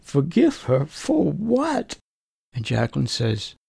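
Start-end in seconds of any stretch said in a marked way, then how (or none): 0.0-2.0 barely holding back tears, pitch breaking upward; choked up crying. Retold or imitated. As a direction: voice cracking high on for what. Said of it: "I'm crying and I can hardly talk".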